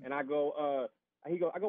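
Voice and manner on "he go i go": ordinary talk, nothing marked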